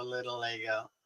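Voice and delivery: ordinary talk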